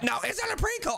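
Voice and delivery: high, squeaky insistence; exasperated protest. Pretend. Child in prison insisting to dad, high exasperated protest. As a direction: shoot the pitch way up in protest.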